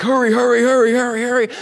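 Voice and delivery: high, loud, urgent repeated hurry; frantic hurry. Retold or imitated. Preacher voicing the three men frantically grabbing water. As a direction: rush them along in a panic. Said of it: "like, frantic".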